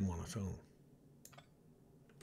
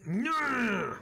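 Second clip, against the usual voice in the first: much higher, louder anguished no; dismayed shout; 10 semitones higher, 3 dB louder than usual. Real, not acted. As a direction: cry out at the loss. Reaction to death.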